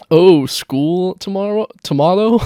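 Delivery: hammed-up disbelief; mock surprise. Mocking his reply to her, mock surprised.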